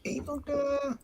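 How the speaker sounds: high, soft teasing evil voice. Acted. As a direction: tease in a little evil voice. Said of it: "evil voice".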